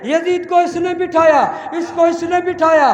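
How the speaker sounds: very high, very loud shouted oratory; fiery climax preaching. Acted. Preacher naming Husain's killers to mourners, shouting. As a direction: rage at the killers at the top of your voice.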